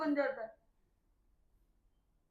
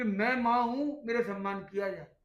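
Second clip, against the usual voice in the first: louder, insistent claim to respect; lightly voiced proud mother; demanding mother; 8 dB louder than usual. A mother insisting her children respect her, louder.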